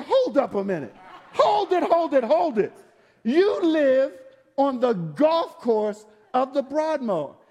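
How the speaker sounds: loud, laughing disbelief; mock disbelief. Shocked at golf-course resident.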